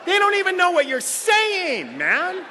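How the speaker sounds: loud and high, laughing; laughing shout. Real, not acted. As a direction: yell it through laughter.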